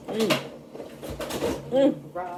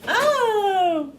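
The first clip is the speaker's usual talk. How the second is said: surprised; 13 semitones higher than usual. Real, not acted. Surprised by peanut butter taste.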